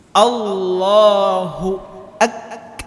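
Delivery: Allahu drawn out slowly, the k of ak- stopped then released with air; slow chanted Allahu with a breathy held k; held takbir. Shown as a demonstration. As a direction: stretch Allahu, catch the k and let breath out. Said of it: "nah, suaranya seperti itu".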